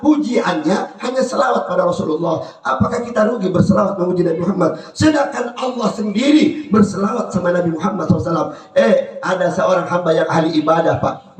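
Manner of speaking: ordinary delivery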